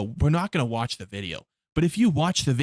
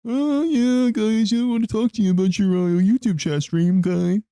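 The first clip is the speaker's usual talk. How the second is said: higher, louder, fake-friendly corporate guy; mock YouTube rep; 7 semitones higher, 4 dB louder than usual. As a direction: play a chummy YouTube staffer at the door. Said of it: "YouTube's gonna come knocking on my door".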